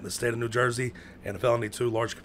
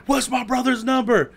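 high, loud shouted question; retold yell; 12 semitones higher, 6 dB louder than usual. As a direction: holler it urgently down the hall. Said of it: "I start yelling".

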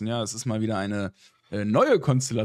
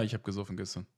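hangover voice; 7 dB quieter, faster than usual. Real, not acted. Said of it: "belegte Katerstimme".

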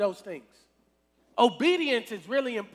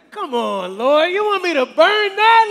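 high, loud pleading outburst; incredulous protest; 7 semitones higher, 11 dB louder than usual. Acted. Tempted believer pleads with the Lord over burning money, loud disbelief. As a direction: cry out to God in disbelief.

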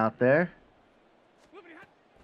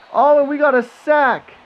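excited shout; 13 dB louder than usual. Real, not acted. Live sack celebration while playing.

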